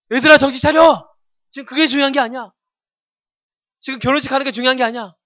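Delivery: hammed-up urgent yell to snap friends out of it; urgent wake-up shout. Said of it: "하면서 막 이렇게 하면서".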